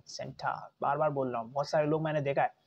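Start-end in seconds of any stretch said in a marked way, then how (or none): 0.1-0.7 "सेंटा" as SEN-tuh with breathy t; British R-less "सेंटा"; schwa ending. Shown as a demonstration. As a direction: end "सेंटा" on an open uh, no R. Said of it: "सेंटा Claus नहीं".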